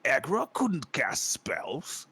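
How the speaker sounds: slow, drawn-out orc puzzlement; baffled orc. Acted. Orcs staring at the spellcaster, slow and baffled. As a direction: drag the words out in swooping disbelief. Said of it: "and they're like".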